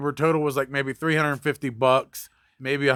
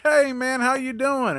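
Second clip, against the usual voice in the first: much higher, sugary friendly greeting; higher, fake-friendly customer greets the mechanic; overly nice; 8 semitones higher, slower than usual. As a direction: play the customer acting sweet as pie. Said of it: "he's, like, being really nice".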